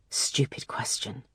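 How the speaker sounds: unvoiced whisper of a private thought; inner thought. A narrator's reading. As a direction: whisper it to yourself. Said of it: "she thought".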